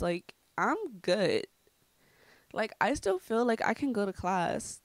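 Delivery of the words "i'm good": "I'm good" slowed down for emphasis; all-caps emphasis. Retold or imitated. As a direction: stretch "I'm good" as if typed in capitals. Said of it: "like, in all caps".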